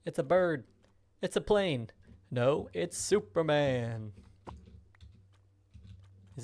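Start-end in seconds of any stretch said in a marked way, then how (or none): 0.1-4.1 loud, high, hammed-up announcer cry; slow down on Superman like a trailer voice; dramatic announcer.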